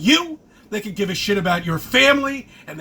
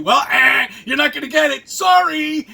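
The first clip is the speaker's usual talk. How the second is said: mocking taunt; 5 semitones higher, 5 dB louder than usual. Real, not acted. Taunting singers about Grammy.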